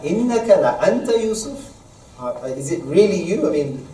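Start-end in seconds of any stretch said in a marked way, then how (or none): 0.0-1.6 raised, quick recited question; incredulous. Retold or imitated. Brothers asking Yusuf whether it is really him, in disbelief. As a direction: ask it in disbelief, rising at the end. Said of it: "they're quite incredulous".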